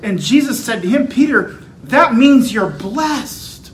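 loud, high preacher's proclamation; belted out. Acted. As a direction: belt it out with clarity and conviction. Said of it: "belt out with clarity and truth".

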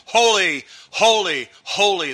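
three loud, high "Holy" calls, each falling; angels crying out. A narrator's reading. Preacher voicing the angels crying out, loud and high. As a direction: cry out "Holy" like a host of angels. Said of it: "angels all around crying out".

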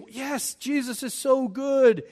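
cheesy sales pitch. Pretend. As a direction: oversell it like a salesman. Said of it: "We say".

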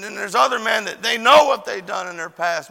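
usual speaking voice

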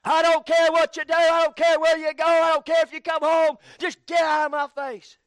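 loud, high, furious father's rant; angry yell. Retold or imitated. A frustrated husband blowing up at his wife and kids, loud angry yell. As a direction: bark it at the family like a fed-up dad. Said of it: "they just lose their mind".

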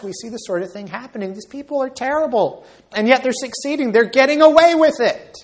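high, rising cry of protest; anguished cry. Acted. Preacher voicing the psalm's cry of the heart, anguished. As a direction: cry it out in pleading dismay. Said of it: "It expresses this cry of our hearts".

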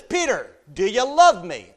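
loud, high, earnest question; the Lord asking. Acted. The Lord asking Peter a second time, loud and high. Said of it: "the Lord says".

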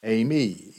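"Eimi" said as AY-mee, falling; Greek eimi. Shown as a demonstration. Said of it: "in Greek, it's just one word".

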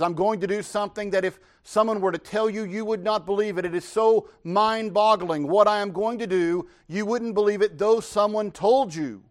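God answering the prophet. Acted. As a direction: announce it as God, with weight. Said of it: "God answers and says".

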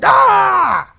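sudden high scream of pain, mock-scared; stung scream. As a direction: yelp as if stung in the back. Beaver stung mid-river.

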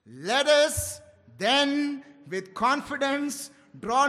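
slow, loud, solemn reading of the verse; fervent slow recitation; scripture reading. Acted. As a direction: proclaim the verse slowly to the church. Reads verse aloud to congregation.

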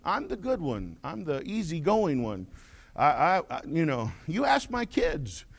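normal talking voice

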